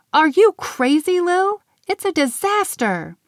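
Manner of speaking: high, loud outraged outburst; exasperated protest. Acted. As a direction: scold her friend in horror. Glitter protests the mess.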